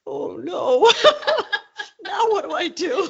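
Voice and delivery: high-pitched laughing mock panic; laughing mock dismay. Reporter retelling her fluster before the president, laughing mock dismay. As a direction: crack up while playing flustered.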